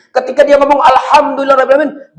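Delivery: high, loud and glad Alhamdulillah; a beaming, thankful Alhamdulillah; joyful praise. Shown as a demonstration. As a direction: say it with a happy heart. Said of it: "dengan penuh senang hatinya".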